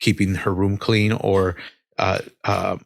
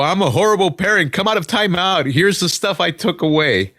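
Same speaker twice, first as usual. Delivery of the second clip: higher pleading self-mockery; guilty parent caving; 8 semitones higher than usual. Parent giving in to sobbing kids, higher pleading. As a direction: cave in like a guilt-ridden parent. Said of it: "I feel bad and go".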